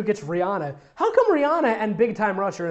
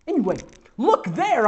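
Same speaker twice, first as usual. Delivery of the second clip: high, urgent exclamation; half-earnest mock scream; 7 semitones higher than usual. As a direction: raise the pitch as if pointing something out. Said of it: "I screamed".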